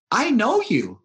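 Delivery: put-on high, loud little boy voice; four-year-old boy telling the pastor, high childlike voice. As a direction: sound like a proud small child. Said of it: "youngest one four or five".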